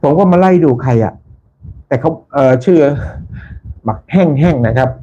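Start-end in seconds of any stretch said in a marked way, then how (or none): none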